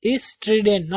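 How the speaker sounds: "स्ट्रिडेन" with a short 'i'; सही उच्चारण. Shown as a demonstration. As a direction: say "स्ट्रिडेन" as is-TRID-en. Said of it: "not स्ट्राइडेन". ("स्ट्रिडेन" में छोटी 'इ')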